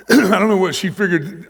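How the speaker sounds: sudden loud, high burst; emphatic outburst. Real, not acted. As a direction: throw the line out with force.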